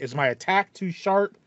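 usual speaking voice

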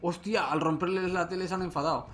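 sudden loud alarmed outburst. Real, not acted. TV monsters suddenly get angry.

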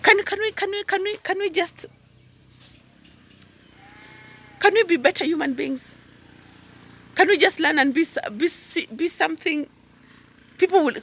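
high-pitched, stumbling plea; pleading appeal. Real, not acted. Appeals to viewers to be better.

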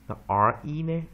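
normal talking voice

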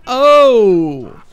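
high, loud drawn-out "oh". Real, not acted. Player after knocking something in game, loud exclamation. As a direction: cry out at a mishap.

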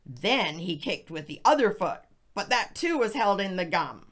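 ordinary delivery

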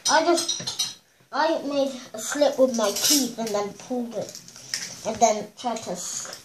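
talking around candy; muffled by sucked sweet; lollipop in mouth. Real, not acted. Seen 0: Stick protrudes from the striped-shirt boy's mouth throughout the labelled words.

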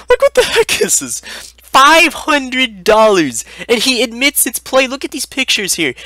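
high-pitched, loud incredulous outburst; shrill-high rant at a ridiculous price; outraged disbelief. Acted. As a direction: blurt it out, high and appalled. Outraged at absurd fake card listing.

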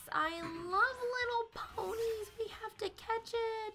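high-pitched squeaky voice. Real, not acted. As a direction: squeak it out over a cute pony. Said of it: "my high-pitched voice is squeaky".

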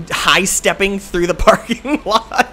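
slow, high, words breaking into laughter; laughing. Real, not acted. Telling how he walked through the lot, laughing. Laughing at his own suspicious walk.

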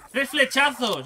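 excited boast. Real, not acted. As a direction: brag it out loud. Marvels at kill.

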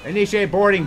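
loud, high command; captain calling to board; boarding order. Acted. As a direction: bark the order at the crew.

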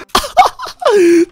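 high cackle at a prank's success; high-pitched laughter. Real, not acted. Prankster hearing his friend scream, high laughter.

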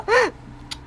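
shrill mock gasp; high squealing gasp. Squealing gasp mid-banter.

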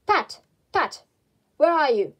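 loud angry rabbit calling Pat. Acted. Rabbit shouting for his gardener Pat, angry call. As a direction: bark for the servant. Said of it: "Next came an angry voice".

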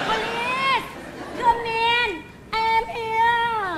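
high, sustained call in English. Acted. Nanny summons the police on herself, calling out high. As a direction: sing out a mock summons to the police.

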